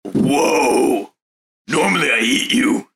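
loud startled character shout; a touch of a brash hungry brute. Acted. Character reacts to bird people.

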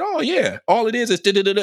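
high, breezy mock Steve Irwin voice; casual Aussie-style mock voice; imitating Steve Irwin. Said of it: "and Steve Irvin said".

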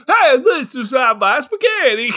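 high, loud cartoon Italian accent; hammed-up Mario-style Italian. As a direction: shout it like a caricature Italian video-game plumber. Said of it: "doing an impression of Mario".

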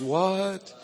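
drawn-out, rising "what?"; astonished. Retold or imitated. Retelling his reaction to Geetika's answer, astonished.

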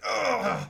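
loud, hammed-up groan of pain; voiceless groan as a blade goes in; pained grunt. Game master as stabbed Angelo, loud pained groan. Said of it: "and he's like".

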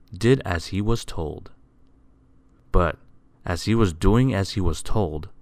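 usual speaking voice

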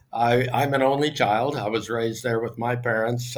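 usual speaking voice

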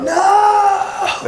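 sudden high, loud shout of refusal; a burst of mock outrage at the idea. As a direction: yell it out in disbelief. Horrified refusal of line dance idea.

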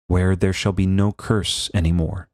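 usual speaking voice

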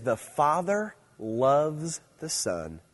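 The Father loves the Son, very slow, word by word; slow reverent reading. Shown as a demonstration. Preacher to teenagers, slow reverent quote. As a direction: savour each word for the teens. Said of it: "Now, get this. You're gonna love this".

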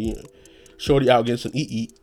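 normal talking voice

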